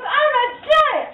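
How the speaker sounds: put-on high, slow boyish giant; young giant voice. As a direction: say it high and proud. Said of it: "He goes".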